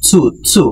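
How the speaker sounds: সু repeated twice as "tsu"; each সু released separately with a ts onset; tsu drill. Shown as a demonstration. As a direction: drill সু with a t before the s each time. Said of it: "বারবার করে এটা একটু উচ্চারিত করতে হবে".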